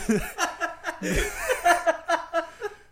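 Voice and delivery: loud high-pitched burst of laughter. Real, not acted. Laughing at a friend's interruption, high burst of laughter.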